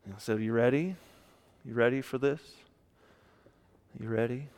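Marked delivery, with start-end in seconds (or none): 0.4-1.0 soft, low, rising "you ready?"; gentle check-in. Retold or imitated. Quiet question backstage before a funeral. 1.6-4.5 soft, low, rising "you ready?"; gentle check-in. Retold or imitated. Quiet question backstage before a funeral.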